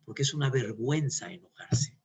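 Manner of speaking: ordinary delivery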